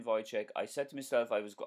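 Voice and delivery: normal talking voice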